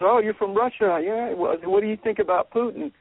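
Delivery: asking a Russian lady on the beach, lightly mock-bright; curious tourist question. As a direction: ask it brightly like a friendly tourist. Retelling his question to Russian lady.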